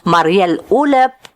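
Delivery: ordinary delivery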